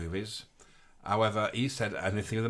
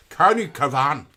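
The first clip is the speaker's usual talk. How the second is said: a loud Northern Irish County Cavan; Northern Irish accent; 8 dB louder, slower than usual. Retold or imitated. Reading a viewer's county aloud in Northern Irish accent. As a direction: belt out the place name in Northern Irish. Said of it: "You're doing that in a Northern Irish voice".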